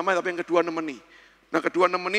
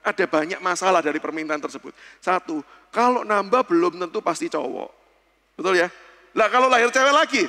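ordinary talk

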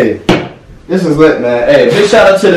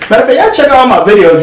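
excited; 8 semitones higher, faster than usual. Real, not acted. Plugging his channel.

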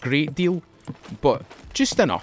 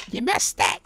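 mock angry commenter; 10 semitones higher than usual. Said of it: "shout at me in the comments".

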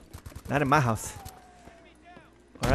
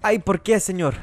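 loud high yelp in hammed-up Spanish; cartoonish Spanish wail after being hit; mock Spanish cry; 7 semitones higher, 7 dB louder than usual. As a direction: ham up the pain, Spanish style.

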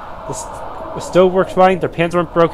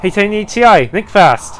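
ordinary delivery

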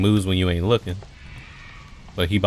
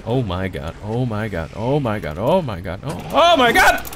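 building panic; 3 semitones higher, 3 dB louder than usual. Real, not acted. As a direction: let the panic build to a shriek. Enemy closes in, panic builds.

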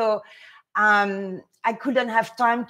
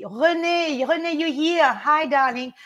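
happy call; 5 semitones higher than usual. Real, not acted. Greeting a viewer.

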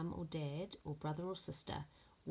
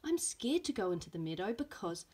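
lively storybook reading with voiced characters; reading to children; 4 semitones higher, 5 dB louder than usual. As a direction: read it like story time for little ones. Teacher reads picture book aloud.

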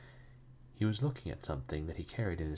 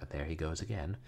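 plain reading voice

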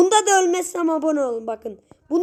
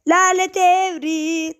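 drawn-out curse; 5 semitones higher, 3 dB louder, slower than usual. Acted. Catchphrase cursing the game.

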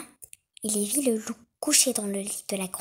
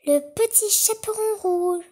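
put-on innocent girl; 9 semitones higher, 4 dB louder than usual. A narrator's reading. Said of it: "répondit sans méfiance l'innocente fillette".

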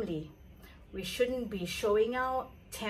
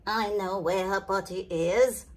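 lower, calm knowing admiral; a steady know-it-all tone; 3 semitones lower than usual. Acted. The admiral answering the panic, calm and knowing. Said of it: "cried the admiral".

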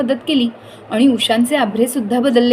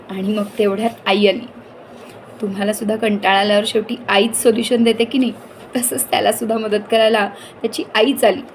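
usual speaking voice

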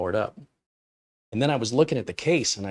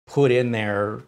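normal talking voice

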